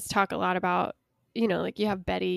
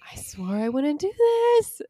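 rising, swelling line, higher and slower; 7 semitones higher, 5 dB louder than usual. Retold or imitated. Friend imitating the singer's vocal swell, higher and slower. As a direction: let the voice swell up high. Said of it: "her voice does sort of swell there".

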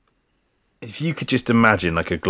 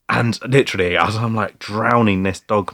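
ordinary talk

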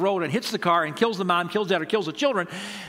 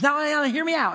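higher-pitched plea for a hearing; head off the objection, pitched up; placating; 10 semitones higher, 3 dB louder than usual. Retold or imitated. Pastor asking a proud dad to listen, placating.

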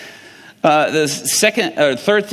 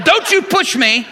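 mock defensive; 14 semitones higher, 4 dB louder than usual. Woman in pain refuses help.

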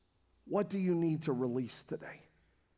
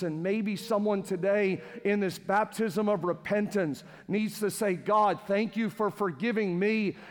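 louder and higher, building to a full-force shout; impassioned preaching; 6 semitones higher, 6 dB louder than usual. Real, not acted. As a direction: preach it, rising to a rousing climax.

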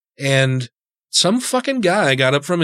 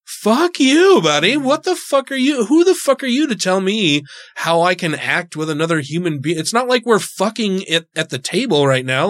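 angry retort; 5 semitones higher than usual. Retold or imitated. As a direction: yell it at the guy telling you off. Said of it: "And I'm like".

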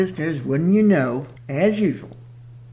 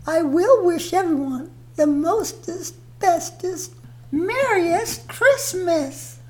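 Mother Nature voice; 13 semitones higher, 4 dB louder than usual. Acted. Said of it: "Mother Nature, would you like".

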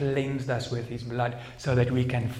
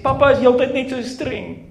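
high, loud, laughing mock sob of a little girl; imitating crying daughter; 13 semitones higher, 13 dB louder than usual. Father mimicking his crying daughter wishing for her mother. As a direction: wail it like a tearful child. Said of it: "I said".

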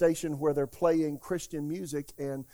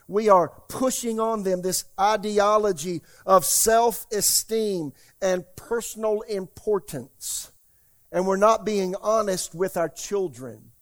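preaching; 2 semitones higher, 5 dB louder than usual. Acted. Pastor warning his congregation, preaching emphatically. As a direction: drive each point home from the pulpit. Preacher opens on spiritual death.

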